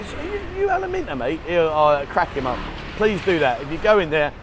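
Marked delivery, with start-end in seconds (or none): 0.0-1.4 much higher, teasing surprise; mock recognition. Viewers teasing the bar owner about his lookalike, mock excited. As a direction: squeal it like you've spotted a celebrity. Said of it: "go and say".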